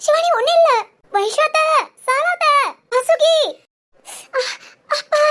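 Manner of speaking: high, urgent calls to the aunts; panicked calling for help. Acted. (பதற்றத்துடன் உதவிக்கு அழைத்தல்)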